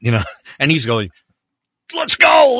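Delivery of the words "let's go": excited shout. Retold or imitated. Director excited after the take.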